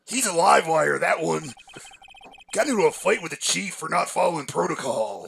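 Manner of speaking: lightly voiced gruff detective; Gumshoe voice. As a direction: play the dopey detective. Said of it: "It's a pretty good voice".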